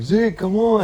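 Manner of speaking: calling friend. Retold or imitated. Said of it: "I was like".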